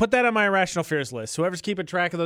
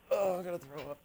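much softer, nauseated; queasy murmur; 10 dB quieter, faster than usual. Real, not acted. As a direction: murmur it, nearly gagging.

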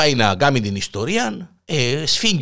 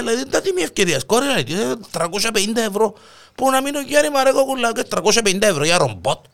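put-on high old woman voice; 10 semitones higher than usual. Old couple quarrel voiced.

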